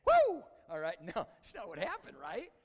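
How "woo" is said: very high, loud whoop; mock magical "woo". Preacher mocking an instant change into fishers of men, whooping. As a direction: let out a sudden high put-on whoop.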